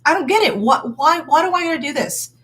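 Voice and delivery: put-on high child voice protesting, whining; imitating a child. Mom voicing her child disagreeing with her, high childish whine. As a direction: play the kid who doesn't understand. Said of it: "Let them say".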